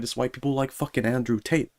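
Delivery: ordinary talk, nothing marked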